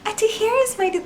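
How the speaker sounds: very high mimicry of a student presenting; mock her squeaky presentation voice, way up high; mocking high-pitched voice. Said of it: "in a high-pitched voice".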